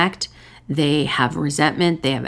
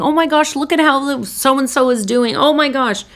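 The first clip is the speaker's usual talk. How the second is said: delighted exclaiming; 6 semitones higher than usual. Retold or imitated. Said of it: "You're saying".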